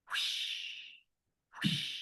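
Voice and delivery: quiet breathy whooshing; soft whoosh. Retold or imitated. Teacher imitating the mysterious noise outside, soft whoosh. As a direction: imitate a distant wave.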